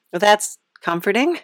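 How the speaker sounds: normal talking voice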